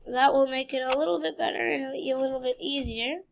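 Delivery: usual speaking voice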